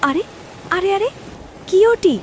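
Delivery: surprised. Acted. Sees something strange in field.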